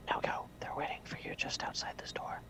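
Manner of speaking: whispered assistant's line. Acted. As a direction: send him off in a whisper.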